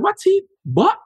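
high, disbelieving squeak; incredulous. Retold or imitated. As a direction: repeat the insult in shocked disbelief. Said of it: "I was like".

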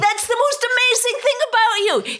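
exasperated, high and incredulous; exasperated high pitch. Real, not acted. Mock exasperated outburst.

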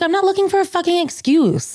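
high, indignant retort; exasperated protest. Acted. Pregnant friend answering people who offer her an excuse, exasperated. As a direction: protest with pitch pushed way up.

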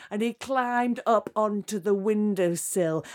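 usual speaking voice

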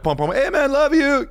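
high, called-out greeting with horn noises; imitated passers-by. People driving by greet the stranded comic, imitated high call. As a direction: mimic fans hollering from a passing car.

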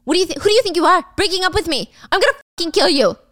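angry threat. Acted. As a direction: rush the words in heated outrage. Acted boyfriend threatening his girlfriend.

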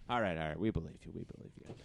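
low, soft reassurance fading out; soothing cop act. Acted. Officer pretends to believe the suspect, soothing. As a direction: drop low and quiet, as if letting them off.

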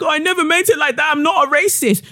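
high, fast whiny mock of Mary crying. Mocking Mary's crying apology in a high whiny voice. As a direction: whimper it out, mocking fake tears. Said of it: "Mary's crying like".

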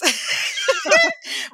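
a real whooping laugh; burst of laughter. Real, not acted. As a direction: let a big laugh burst out. Retelling a funny hair disaster.

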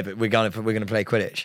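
ordinary talk

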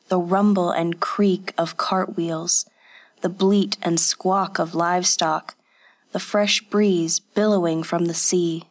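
ordinary delivery, nothing marked

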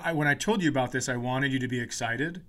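ordinary talk, nothing marked

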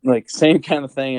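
normal talking voice

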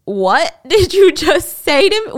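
Sarah can't believe what she heard; shocked disbelief. Retold or imitated. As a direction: let the outrage build slowly. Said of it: "Sarah is taking this in like".

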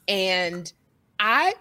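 normal talking voice

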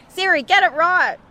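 high, sharp telling-off; mock cross mum to Siri; scolding Siri. Shown as a demonstration. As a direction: scold the phone impatiently. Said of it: "scolding Siri".

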